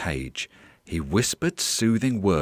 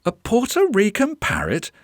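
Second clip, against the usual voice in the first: "Puerto Rican parrot?" high, tune rising at the end; high-pitched, amazed echo question; surprised question; 13 semitones higher than usual. Acted. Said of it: "Dominic's eyebrows shot up".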